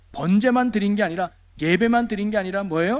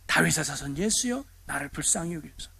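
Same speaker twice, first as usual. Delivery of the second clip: ordinary delivery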